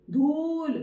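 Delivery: धूल with ऊ held long and pushed. Shown as a demonstration. Teacher contrasting vowels for pupils, long forceful ऊ in धूल. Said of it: "time ज्यादा लगा".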